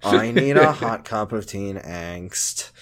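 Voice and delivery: put-on low, slow, mopey teen drawl; Ashley voice. Host doing Ashley's mopey voice for the co-host, low slow drone. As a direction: drone it like a gloomy goth teen. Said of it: "I was doing Ashley voice".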